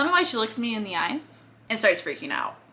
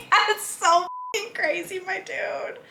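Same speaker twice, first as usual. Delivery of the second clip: high, slow, giggly amazement; laughing disbelief; 8 semitones higher, 5 dB quieter, slower than usual. Real, not acted. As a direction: drag it out through laughter, fading away. Laughing in disbelief at new friendship.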